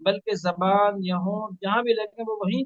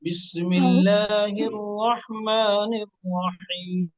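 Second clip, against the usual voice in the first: बिस्मिल्लाहिर रहमानिर Rahim drawn out slowly, syllable by syllable; slow model recitation; much slower than usual. Shown as a demonstration. Teacher models slow recitation for student. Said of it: "सिखाया गया उस तरह पढ़िए".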